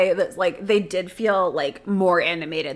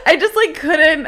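laughing; 8 semitones higher, 6 dB louder than usual. Real, not acted. Laughing at the memory.

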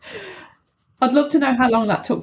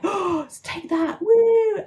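mock crowd cheer; 9 semitones higher, much slower than usual.

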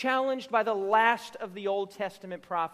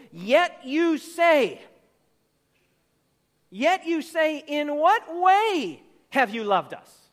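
louder, slow, disbelieving pushback; incredulous retort; 4 semitones higher, 6 dB louder, much slower than usual. Retold or imitated. Preacher voicing the doubting people answering God, incredulous. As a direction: question it as if offended. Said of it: "the people respond with incredulity".

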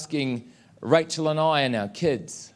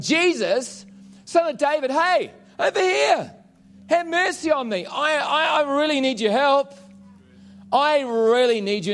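much higher, pleading loud call; desperate cry out; 10 semitones higher than usual. Retold or imitated. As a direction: cry out to be heard over a crowd. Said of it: "He's the loud guy and starts saying".

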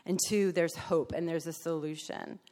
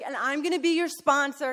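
high, louder eager sponsor voice; 8 semitones higher, 7 dB louder than usual. Retold or imitated. Sponsor claims the newcomer, high and bubbly. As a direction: announce it with bubbly glee. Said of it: "she's like".

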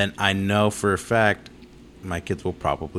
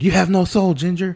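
much higher, louder cartoon-kid taunt; mock taunt; 12 semitones higher, 7 dB louder than usual. Friend quoting the cartoon's jab at the redheaded kid, high and loud. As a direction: squeal it like a bratty cartoon kid.

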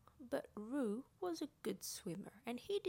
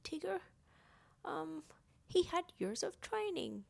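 high-pitched animal character, thrilled then unsure; excited story character; 8 semitones higher, 4 dB louder than usual. Acted. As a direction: exclaim it high, then hesitate.

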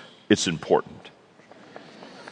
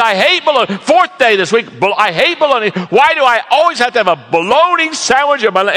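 hammed-up lunch-pail complaint; griping worker; 9 semitones higher than usual. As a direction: whine it like a fed-up laborer. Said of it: "He says".

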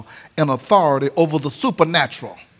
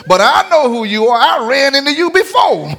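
higher, rising mock demon voice; demon taunting; 7 semitones higher, 3 dB louder than usual. Demon voice, preached loudly.

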